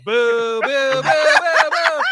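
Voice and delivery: high, loud, long-drawn boos; crowd booing. Retold or imitated. Audience booing the comic who threatened them, loud and climbing.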